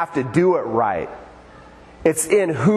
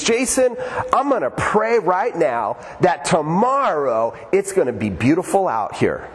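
super-spiritual friend; 3 semitones higher than usual. Retold or imitated. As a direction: imitate a man grandly announcing his prayer. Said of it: "he acts like he's super spiritual".

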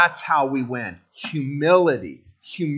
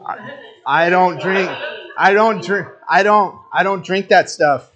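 louder, higher, hammy insistence on DON'T; loud mock protest; 4 semitones higher, 6 dB louder than usual. Real, not acted. Pastor telling the congregation he won't touch the coffee, louder and higher. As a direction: protest it like a man refusing poison, hitting DON'T.

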